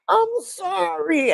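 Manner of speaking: high, drawn-out mock-pitiful sorry; sympathetic apology. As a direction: stretch it out high, full of sympathy. Retold apology to sad man.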